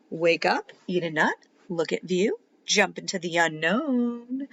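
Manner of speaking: put-on lively read-aloud for kids; animated children's story reading; story narration. A narrator's reading. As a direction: read it like a bedtime picture book. Reads picture book to children.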